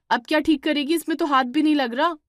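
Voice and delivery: exasperated elder sister's rebuke; angry scolding. Acted. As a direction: snap at her for ruining the dough. Divya scolds Shweta over dough.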